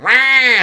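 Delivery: very high, loud yelp; startled yelp. Acted. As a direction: let out a cartoon yelp of alarm. Character yelps in comic.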